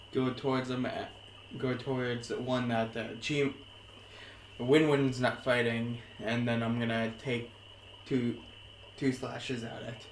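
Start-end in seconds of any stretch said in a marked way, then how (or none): none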